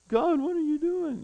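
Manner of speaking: high-pitched whining complaint; whiny Elijah. Retold or imitated. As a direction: whine at God like a sulking child. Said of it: "he's almost whiny".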